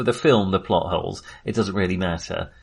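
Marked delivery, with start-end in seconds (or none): none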